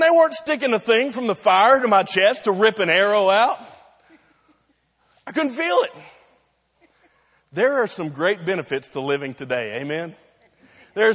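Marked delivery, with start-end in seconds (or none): 5.3-5.9 high-pitched exclamation. Retold or imitated. Mock exclamation ending surgery story.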